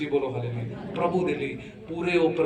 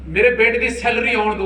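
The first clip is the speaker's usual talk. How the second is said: very high, loud mock promise; loud, high-pitched imitation of a congregant; imitating eager churchgoer; 10 semitones higher, 8 dB louder than usual. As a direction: play a parishioner eagerly putting off the pastor. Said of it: "ਆਪਣੇ pastor ਨੂੰ ਕਹਿੰਦੇ ਨੇ".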